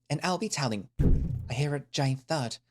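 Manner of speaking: usual speaking voice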